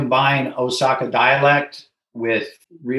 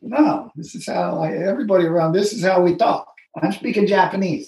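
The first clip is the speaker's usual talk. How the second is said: retold protest; 6 semitones higher than usual. Retold argument over his dialect.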